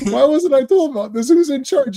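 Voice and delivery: retelling the scene, mock indignant; indignant demand. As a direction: mimic the commissioner's indignant question. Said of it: "He's like".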